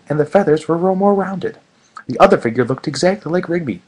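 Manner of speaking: normal talking voice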